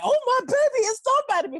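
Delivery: high, proud mother's coo; proud mom. Retold or imitated. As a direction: gush like a mom bragging. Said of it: "so it wasn't until then, like".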